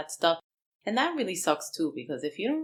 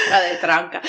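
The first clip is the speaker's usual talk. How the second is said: laughing; 3 semitones higher, 10 dB louder than usual. Real, not acted. Friend laughs hard at a Cuban phrase, real laughing. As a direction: laugh through the words.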